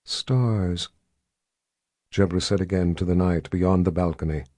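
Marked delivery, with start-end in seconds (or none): 0.0-0.9 slow, hollow, eerie tone; haunting voice. Acted. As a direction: let it hang, eerie and distant. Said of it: "in a haunting voice".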